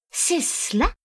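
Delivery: high-pitched, incredulous question; taken aback. A narrator's reading. Husband reacting to his wife's reproach, taken aback. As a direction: exclaim it in disbelief. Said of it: "s'exclama-t-il interloqué".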